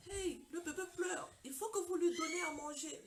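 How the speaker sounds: lightly voiced doctor alerting a mother; retold doctor urging. Doctor tells new mother the baby cries, mildly urgent.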